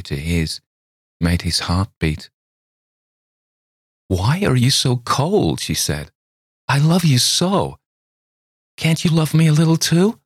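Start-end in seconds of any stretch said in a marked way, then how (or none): 4.1-5.6 high, slow wounded question; reproachful girl. A narrator's reading. Girl asks Campbell why he is cold, slow and high. 6.7-10.2 very high, louder pleading; pleading girl. A narrator's reading. Girl begs Campbell for love, high and pleading.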